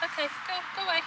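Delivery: scared. Real, not acted. Shooing a big spider.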